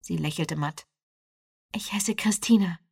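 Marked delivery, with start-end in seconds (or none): 1.7-2.8 whispering. A narrator's reading. Gentle words to a wounded man.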